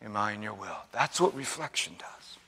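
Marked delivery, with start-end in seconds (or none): 0.0-0.8 hushed, searching repeat; quiet echo. Shown as a demonstration. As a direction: repeat the question much softer. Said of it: "repeated right after, softer".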